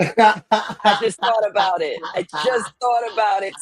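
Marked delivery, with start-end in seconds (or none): 0.0-2.0 slow words broken by laughter; laughing. Real, not acted. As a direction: chuckle through the line. Laughing at own idea.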